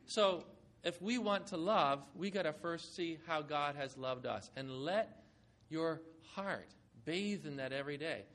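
ordinary talk

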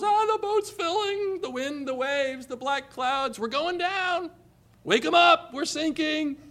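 high, frantic cry of sinking men; acted panic, voice pitched high; panicked disciples. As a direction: play the terrified disciples in the storm.

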